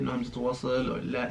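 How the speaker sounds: ordinary talk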